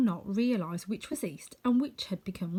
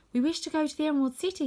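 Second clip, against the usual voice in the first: put-on girl's voice, Dorothy; 8 semitones higher, faster than usual. Dorothy answers the king.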